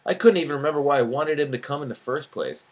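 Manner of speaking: ordinary delivery, nothing marked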